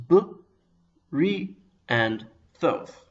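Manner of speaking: "b, ri, and thoth" as separate, slow syllables. Shown as a demonstration. Teacher counting out three syllables of a Hebrew word, syllable by syllable. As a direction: break it into "b", "ri", and "thoth", pausing between. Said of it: "It has three syllables".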